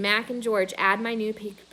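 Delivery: plain reading voice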